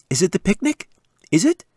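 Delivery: put-on high, bouncing kid voice; can barely sit still; eager excitement. Daughter guessing the picnic, high and eager. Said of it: "could hardly sit still".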